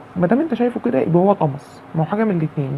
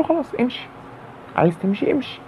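cold dismissal; 6 semitones higher, 5 dB quieter than usual. Retold or imitated. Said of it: "عاملته ببرود".

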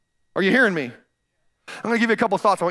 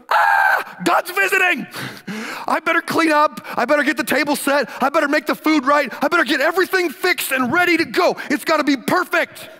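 frantic Martha; 7 semitones higher than usual. Retold or imitated. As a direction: fret like a host racing to tidy up. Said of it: "Martha immediately goes".

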